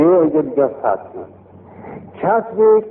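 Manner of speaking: normal talking voice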